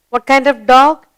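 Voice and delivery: normal talking voice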